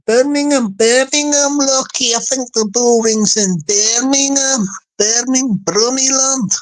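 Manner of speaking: Birmingham accent. Shown as a demonstration. Placing the Bull Ring in Brummieland, in Birmingham accent. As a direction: slip back into mock Brummie.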